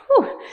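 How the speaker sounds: high, short whooped whew; exertion whew. Real, not acted. Coach finishing twenty marches, high relieved-effort whew.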